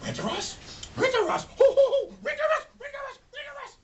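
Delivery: very high, howling "Winter Ross"; dog howl. Acted. The dog howling Santa's name, very high and loud. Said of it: "which means Santa Claus in dog language".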